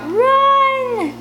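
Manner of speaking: a high, loud shout of alarm. Acted. A bat dive-bombs the narrator and she yells to the others, shouting. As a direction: yell it as a bat dives at you. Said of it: "and I shout".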